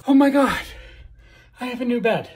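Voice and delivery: high, loud delighted cry; excited. Real, not acted. Seeing the new bed done, excited cry. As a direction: squeal with joy.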